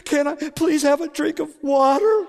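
put-on high, sweet child's request; pleading little girl. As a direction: beg sweetly like a toddler. Daughter asks for water.

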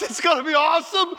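high, bright joyful promise; excited. Retold or imitated. Jesus telling the thief what waits, excited. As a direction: say it with happy anticipation. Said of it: "He starts laughing out loud".

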